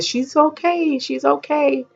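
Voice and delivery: high, singsong reassurance from a distance; calling out reassurance. Retold or imitated. Medical staff telling the mother from over there, reassuring. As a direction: imitate staff calling over that all is well. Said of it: "telling me from over there".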